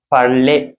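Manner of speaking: "Parler" said as "par-lay"; final A sound. Shown as a demonstration. Teacher pointing out the accent aigu sound, clear "ay" in "Parler". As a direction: draw out the "ay" ending of "Parler". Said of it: "A sound is coming there".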